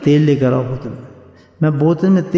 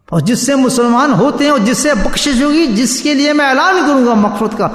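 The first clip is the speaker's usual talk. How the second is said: rising, fervent sermon voice; preaching; 6 semitones higher than usual. Acted. Preacher exalts the Prophet before listeners, fervent. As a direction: build it up for the crowd.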